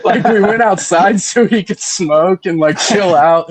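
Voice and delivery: amused, laughter breaking into the words; laughing while telling. Real, not acted. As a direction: tell it through real laughter. Laughing at own story.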